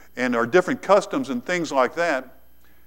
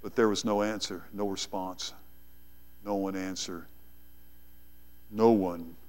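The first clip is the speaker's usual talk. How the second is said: much softer, lower, with long dramatic pauses; softer and lower, hanging on each short phrase; hushed sermon narration; 8 semitones lower, 13 dB quieter than usual. Acted. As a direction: let the silence of the altar sink in.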